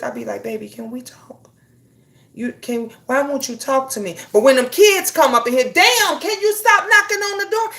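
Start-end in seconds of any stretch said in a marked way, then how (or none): 0.4-4.2 much softer, pleading to husband; tender hushed plea; sweet tone. Retold or imitated. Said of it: "that tone changed so quick". 5.7-7.7 put-on higher, irritated yell at the kids; mock annoyed mom; snapping at kids. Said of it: "It ain't they fault".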